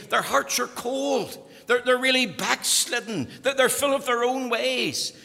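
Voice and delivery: loud, high preaching build; preaching at full force. Acted. Preacher thundering at the congregation, loud and high. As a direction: drive the point home to the congregation.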